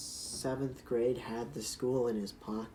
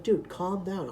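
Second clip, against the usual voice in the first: teacher; 6 semitones higher, 6 dB louder, slower than usual. Retold or imitated. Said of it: "And the teacher's like".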